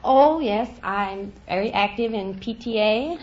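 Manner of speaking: usual speaking voice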